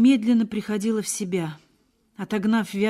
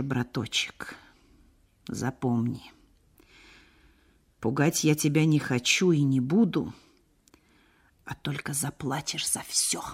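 hushed menace, pitched down; quiet; 3 semitones lower than usual. A narrator's reading. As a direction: warn him softly and deliberately, with long pauses. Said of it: "сказал тихо".

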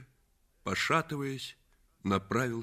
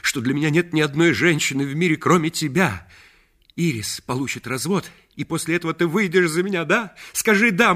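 high, loud, fast begging; urgent pleading; 8 semitones higher, 9 dB louder than usual. Acted. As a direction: press her for a yes. Reggie pleads for her yes.

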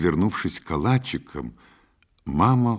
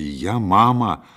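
slow, louder mock-authority; forced playfulness; 3 semitones higher, 6 dB louder, slower than usual. A narrator's reading. Mother trying to joke with her son, forced playful. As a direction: attempt a joking tone, drawing it out. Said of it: "придать своему голосу шутливой интонации".

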